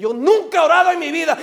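fast, high, loud protest; emphatic objection. Acted. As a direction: fire off the denial at full voice. Voices a doubting listener's objection.

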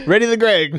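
high-pitched play battle order; joining in as the catapult officer; mock commander. Friend joins the catapult joke, mock command.

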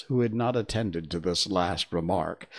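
usual speaking voice

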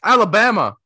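loud, high exclaim of surprise; surprised. Real, not acted. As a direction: blurt out the discovery. Surprised discovering the answer.

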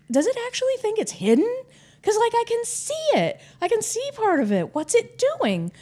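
high, astonished inner voice; stunned disbelief. Retold or imitated. Woman spotting Bigfoot in the woods, high stunned disbelief. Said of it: "And it's like".

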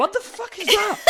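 high, laughing shocked outburst; high laughing mock shock; shocked disbelief. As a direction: recoil at the marshmallow, laughing. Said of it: "And I was like".

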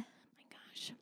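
breathy slow whisper. Real, not acted. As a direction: whisper it slowly. Aside after laughing.

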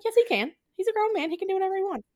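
sarcastic high mimicry of a dismissive friend; mock Tyler retort. Tyler brushing off Eleanor, mock high retort. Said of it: "He's like".

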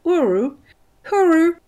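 "uru" called out as Australian slang; Australian goodbye. Shown as a demonstration. Demonstrating how Australians say goodbye, Australian-style "uru". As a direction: say "uru" like an Australian farewell, rising on the repeat. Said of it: "les Australiens, pour dire au revoir, ils font".

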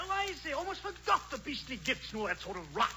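mock-English Santa, caught out; English Santa Claus. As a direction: play the forgetful English Santa. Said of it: "English Santa Claus".

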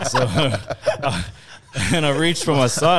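loud high laughter; laughing. Real, not acted. Telling mates his McDonald's tale, laughing loudly. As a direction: crack up mid-story.

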